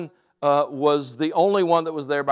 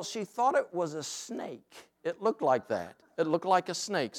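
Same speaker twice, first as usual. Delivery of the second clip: ordinary delivery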